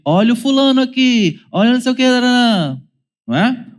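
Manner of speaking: put-on high, whiny kid complaint; child complaining to mother about sibling, whiny; mock tattling child. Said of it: "acompanhado de".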